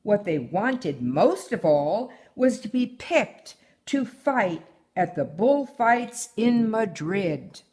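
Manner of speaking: ordinary delivery, nothing marked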